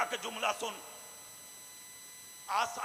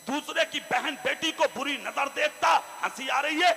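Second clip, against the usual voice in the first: loud scolding sermon, slowing on key lines; fiery preaching; 5 semitones higher, 10 dB louder than usual. Acted. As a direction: rebuke them at full voice.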